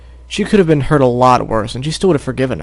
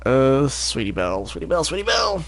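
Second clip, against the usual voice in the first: voice warm-up; 6 dB quieter than usual. Shown as a demonstration. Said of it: "High and squeaky".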